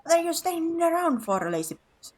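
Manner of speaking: a hint of a nagging elder; bossy character.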